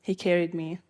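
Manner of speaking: plain reading voice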